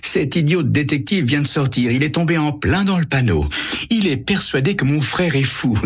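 high, scheming sneer; woman mocking the detective to her lover, gloating. A narrator's reading.

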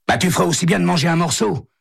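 high, quick character voice; phone caller. A narrator's reading. Caller asks Santo when he will arrive, high and brisk. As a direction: pitch the caller well up and keep it brisk.

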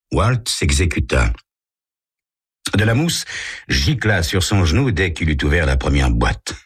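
normal talking voice

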